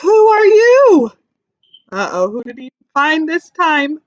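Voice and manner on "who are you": put-on much higher, louder and slow baby voice; teacher voicing Joey meeting a bee, high and slow; curious little joey. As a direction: ask it wide-eyed like a tiny kangaroo.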